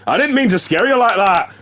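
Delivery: high Irish woman's voice; wobbly put-on Irish lilt; accidental Irish accent. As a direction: play her Irish, pitched well up. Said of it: "Apparently she's Irish now".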